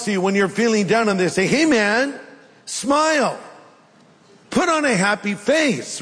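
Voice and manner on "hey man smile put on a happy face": high, chirpy pep talk; hammed-up upbeat encouragement, pitched up; mock cheeriness. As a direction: cheer someone up far too brightly. Mimics an annoying cheerer-upper.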